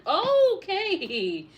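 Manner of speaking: bursting out laughing, high and loud. Real, not acted. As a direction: laugh it out at the cat. Cat jumps up, laughing.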